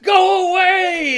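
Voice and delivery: very high, loud, stretched yell; hammed-up cartoon holler; drawn-out shout. Hiding man pops out from under the couch, hammed-up shout.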